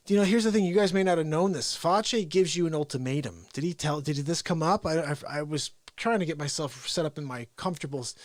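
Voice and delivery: ordinary talk, nothing marked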